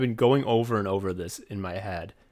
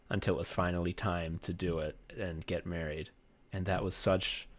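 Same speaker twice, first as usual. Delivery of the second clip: soft, lower-pitched, sincere storytelling; tender reminiscence; 5 semitones lower, 7 dB quieter than usual. Real, not acted. Husband remembering his wedding day, tender reminiscence. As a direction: recall it quietly and sincerely, pausing between phrases.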